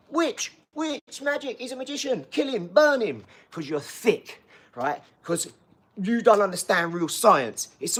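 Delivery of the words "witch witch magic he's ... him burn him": high-pitched, hammed-up accusing villager; mock witch-hunting mob. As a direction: cry out like a panicked mob spotting a witch. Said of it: "you'd go".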